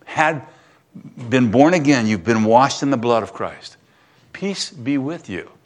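usual speaking voice

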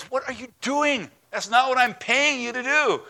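high, indignant complaint; a king furious at his hired sorcerer. Retold or imitated. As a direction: demand what he's playing at. Balak angry at Balaam.

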